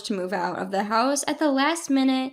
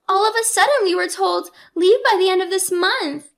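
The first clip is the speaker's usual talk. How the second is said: higher, urgent alarm; a distressed sister reporting bad news, pitched up; 6 semitones higher, 4 dB louder than usual. A narrator's reading. Sister tells Kathy about the eviction, alarmed and high.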